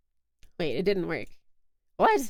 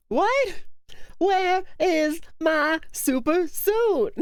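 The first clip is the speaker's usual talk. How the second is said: slow, stretched, higher indignant yell; mock indignant movie quote; 6 semitones higher, much slower than usual. Streamer quoting Frozone, slow and hammed-up. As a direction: demand the suit, dragging every word. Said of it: "That's what it was".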